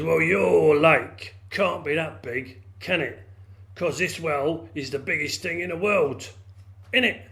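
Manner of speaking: loud, drawn-out bullying frog voice; angry well frog. A narrator's reading. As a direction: challenge him as a big cross frog. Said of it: "he's getting a bit angry".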